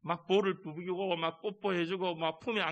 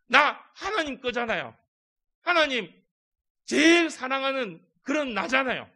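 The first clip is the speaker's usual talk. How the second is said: high, loud, eager and pleading; eager answer; 8 semitones higher, 8 dB louder than usual. Acted. Believer answering God eagerly, high and loud. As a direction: gush it like a beloved child.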